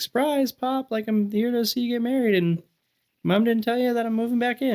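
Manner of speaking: high, sing-song surprise announcement; mock cheerful surprise. Son tells his father he is moving back, high mock-cheerful. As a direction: announce it like a cheeky gift. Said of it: "I was like".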